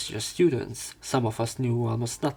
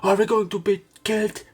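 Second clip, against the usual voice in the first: high, loud frightened chatter; mock panic from the whispering class; panicked classmates; 8 semitones higher, 6 dB louder, faster than usual. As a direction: imitate scared students crying out. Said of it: "hear left and right".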